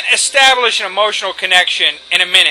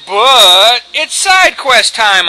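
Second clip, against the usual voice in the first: drawn out; 5 dB louder, much slower than usual. Real, not acted. Announcing side quests.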